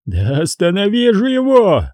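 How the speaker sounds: high frantic bellow; roaring like a madman. Acted. Обезумевший Хеннесси ревёт на Дантека, безумный рёв. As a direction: roar it out, out of your mind. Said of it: "словно безумец ревел Хеннесси".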